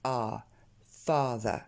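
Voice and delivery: A held slowly, then FATHER as FAA-thuh; stressed long A. Shown as a demonstration. Teacher showing stress on the first A, long English vowel. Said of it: "tombe bien sur le premier".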